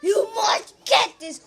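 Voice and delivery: loud, high ad shout. Pretend. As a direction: yell it like a TV ad. Kid shouts ad pitch.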